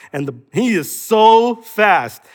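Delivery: usual speaking voice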